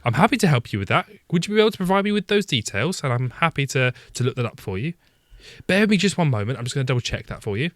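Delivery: sweet, polite call centre agent; customer service voice. Acted. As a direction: sound endlessly helpful on the phone. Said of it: "I can do a customer service voice".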